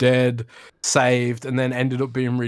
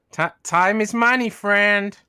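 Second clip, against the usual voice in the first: higher New Yorker-style goblin; half-hearted goblin catchphrase, pitched up; 6 semitones higher, 4 dB louder, slower than usual. Acted. Co-host doing his roleplay goblin for a super chat, goblin voice. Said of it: "That's not your goblin character voice".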